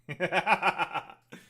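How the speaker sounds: bursting out laughing; big amused laugh; loud laugh. Real, not acted. Laughs at own joke.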